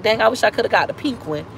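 ordinary delivery

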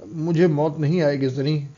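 plain reading voice

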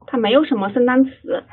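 usual speaking voice

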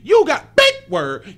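loud, slow mock pride on big word; proud announcement of a big word. Real, not acted. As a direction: show off the fancy word loudly. Mock-proud remark on his own word.

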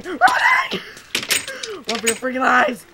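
high shriek into loud yelling; panicked yelling. Pretend. Player fleeing danger in game, panicked yell. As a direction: yell like you're fleeing for your life.

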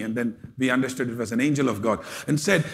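ordinary delivery

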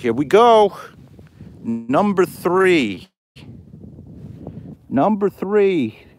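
high, drawn-out celebratory call; excited announcement. Real, not acted. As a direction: announce the find in a high, stretched voice.

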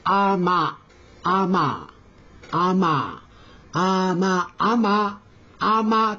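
the word あま said slowly several times; repeated Korean word. Shown as a demonstration. Teacher repeating a Korean word for learners. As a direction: repeat あま carefully for students to copy. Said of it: "韓国語では".

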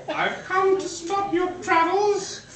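high alien voice through giggles; laughing high cartoon alien; alien villain laughing. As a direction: sneer at Santa, half laughing.

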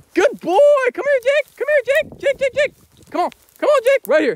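very high, loud praise and calling; high-pitched dog praise. Real, not acted. Owner calling his dog back, very high and loud. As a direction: squeal it to the dog like praising a puppy.

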